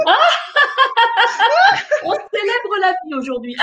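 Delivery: laughing through the words, high and bright. Pretend. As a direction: celebrate life while laughing aloud. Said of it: "un grand rire, si vous voulez".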